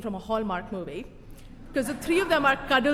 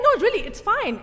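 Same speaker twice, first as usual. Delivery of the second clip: high, louder brush-off; acted high-pitched polite refusal; high protest; 9 semitones higher, 6 dB louder than usual. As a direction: insist it's fine, pitched way up. Waving off an invitation.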